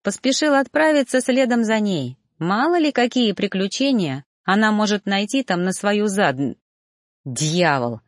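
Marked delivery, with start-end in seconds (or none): none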